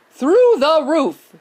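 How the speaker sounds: slow, loud showman reveal; grand announcement. A narrator's reading. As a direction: proclaim it like a showman. Wonka announces loudly.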